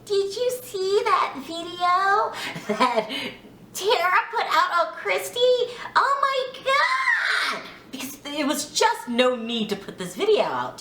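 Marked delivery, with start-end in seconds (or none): 0.1-7.6 high drawn-out mock sweet voice, breaking into laughter; sweet Jasmine. Recapper mimicking castmate's shock at the video, high and drawn out. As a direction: imitate her slow, high-pitched talk, stretching the ends. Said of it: "she, she talks really".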